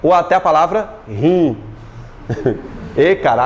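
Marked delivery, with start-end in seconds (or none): none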